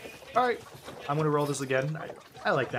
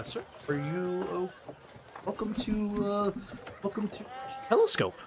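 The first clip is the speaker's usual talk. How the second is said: posh telescope keeper; 6 semitones higher, 7 dB quieter than usual. Acted. GM voices telescope keeper NPC.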